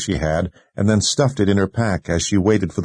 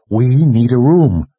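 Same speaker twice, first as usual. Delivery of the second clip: raised, louder, slow demand; insistent demand; 5 semitones higher, 6 dB louder than usual. A narrator's reading. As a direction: declare it firmly, meaning to have it. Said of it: "in a tone that said she meant to have it".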